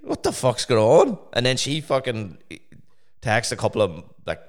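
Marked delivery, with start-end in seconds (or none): 0.0-1.2 retold shocked question. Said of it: "I'm like".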